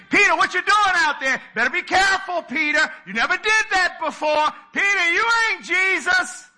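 high, loud teasing shouts with a laugh; mocking disciples. Disciples in the boat jeering at Peter, high loud laughing shouts. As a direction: heckle him from the boat. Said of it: "they were saying".

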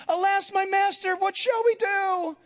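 alarmed servant. Acted. Servant to his master at seeing the army, alarmed. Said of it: "And his servant said to him".

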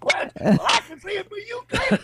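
mock high whine of protest; mock whiny reply. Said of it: "you could be like".

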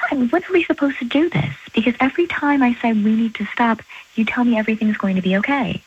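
high-pitched, exasperated pleading; upset protest. Real, not acted. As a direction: plead in frustration, voice pitched well up. Argues with lover on phone.

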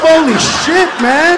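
normal talking voice

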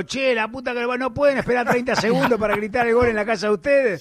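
griping like mad, hammed up; imitated angry cursing. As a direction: act out an exasperated neighbour cursing. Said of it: "hay uno puteando como loco".

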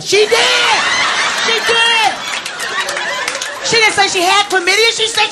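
high, laughing insistence; insistent shout. Real, not acted. Comic insisting to the audience, high laughing shout. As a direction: yell it over the laughter.

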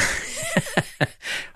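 laugh. Real, not acted. Host laughs at caller's story.

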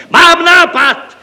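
very high, loud, drawn-out outburst; joyful shout. Acted. Deputy referent has an idea, shouting joyfully. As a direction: cry it out in triumph. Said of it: "vykřikl radostně zástupce".

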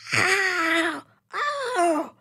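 high sharp pained yelps; yelping in pain. Acted. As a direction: yelp as the strip tears. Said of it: "he yelped".

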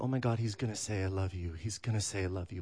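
soft, fast, nervous inner thoughts. Retold or imitated. Driving his boyfriend, a soft nervous inner voice. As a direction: hush it like a panicked secret. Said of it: "I was so nervous".

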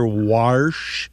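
"warsh" with an ah vowel and an R; dialect warsh. Shown as a demonstration. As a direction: draw out "warsh" with R before sh. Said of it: "you do hear".

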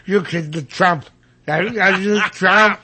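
mocking drugged-up impression; heavily sedated speaker. Radio guest mocking a politician at a debate, drugged-up voice. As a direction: garble it like someone doped on sedatives. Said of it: "a half gallon of Thorazine".

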